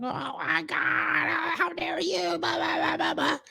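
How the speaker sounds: mock shrieking girlfriend. Mocking the girl he demoted with a squeaky shriek. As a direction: mimic her freaking out in a squeaky half-whispered shriek. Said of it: "She went feral. She went crazy".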